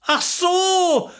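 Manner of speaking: high, excited exclamation; thrilled, pitched right up. A narrator's reading. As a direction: squeal with eager excitement. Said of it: "rief sie aufgeregt".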